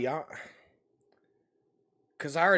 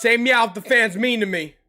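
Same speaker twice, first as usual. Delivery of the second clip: high, loud playful command; loud calling out; 6 semitones higher, 9 dB louder than usual. Pretend. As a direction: call out to a cat. Calls out to meowing cat.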